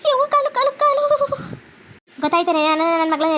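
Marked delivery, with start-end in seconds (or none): 0.1-1.0 high, wailing cries of pain; crying in pain. Acted. Wife with burnt leg begs to be let go, wailing in pain. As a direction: cry out as if your leg is burning.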